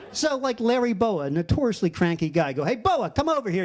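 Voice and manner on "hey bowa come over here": higher, calling across the field. Retold or imitated. Calling out to a coach.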